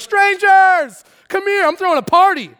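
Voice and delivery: high, loud excited invitation; shepherd calling strangers to a party. Acted. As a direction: shout it down the road.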